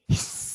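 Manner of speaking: a short playful snake hiss. Pretend. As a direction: hiss like a little snake for the kids. Kids' yoga, countdown to snake hiss.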